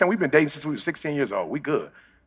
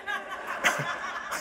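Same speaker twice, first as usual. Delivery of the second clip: soft breathy laugh; 13 dB quieter than usual. Real, not acted.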